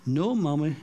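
small wide-eyed innocent voice; lightly voiced little girl denying it. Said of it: "with her big wide-eyed innocent eyes says".

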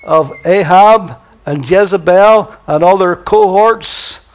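God promising Elijah victory, booming; bold confident God. Acted. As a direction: assure him it's handled. God reassuring Elijah boldly.

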